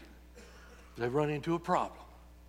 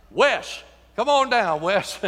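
calling out; 5 semitones higher, 7 dB louder, slower than usual. Real, not acted. Calling a man up front.

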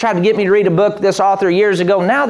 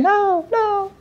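much higher, softer mock protest; spirit man; 12 semitones higher, 4 dB quieter than usual. Preacher voicing the weak spirit man, timid and high. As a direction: squeak out a timid no. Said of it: "your spirit man saying".

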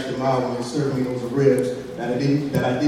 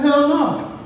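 mock tough refusal; 12 semitones higher than usual. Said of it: "So I'm like".

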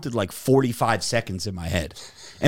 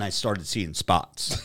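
slow, much softer, trailing off; telling friends how he nearly fainted, slowed and much softer; fading; 6 semitones lower, 7 dB quieter, slower than usual. Retold or imitated. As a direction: let the voice sink like you're about to faint.

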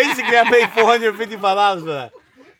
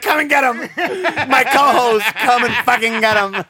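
laughing, raised-voice summons; mock calling out; 2 semitones higher than usual. Turning in his co-host as a joke, laughing call. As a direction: call out through your own laughter. Jokingly calls police on co-host.

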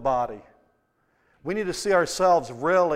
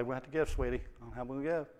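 soft to his wife; 4 semitones lower, 12 dB quieter, faster than usual. Retold or imitated. Tender words to wife.

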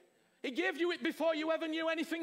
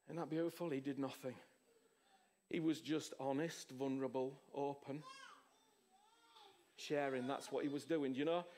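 softer and lower, intimate; tender hush; 10 semitones lower, 6 dB quieter than usual. Real, not acted. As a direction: draw them close and gentle. Tender hushed reflection.

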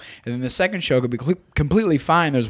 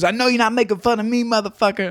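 lightly voiced heckler, pitched up; higher-pitched indignant comeback; heckler woman; 8 semitones higher than usual. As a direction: challenge him in a higher voice. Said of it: "And she goes".

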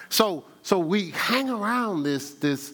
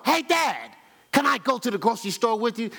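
put-on eager little kid; 5 semitones higher than usual. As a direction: play the eager son tugging at Dad. Child voiced asking dad.

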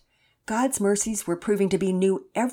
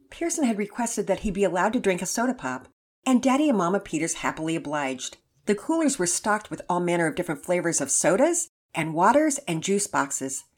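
usual speaking voice

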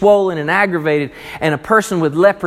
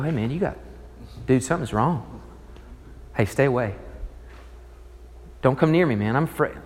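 hushed, wary warning to a sick buddy; mock fearful friend; 4 semitones lower, 8 dB quieter, faster than usual. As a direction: back away nervously, voice low.

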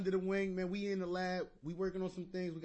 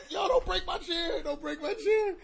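laughing shout; 11 semitones higher, 6 dB louder than usual. Real, not acted. Shouting at choking friend.

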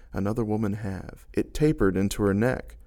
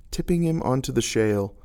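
ordinary delivery

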